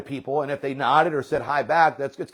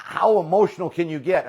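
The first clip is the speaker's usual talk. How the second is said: ordinary talk, nothing marked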